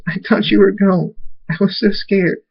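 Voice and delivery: put-on high-pitched, distressed young voice; upset child. A narrator's reading. Daughter hugging her returned father, upset. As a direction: pitch it high, pouring out relief and fright.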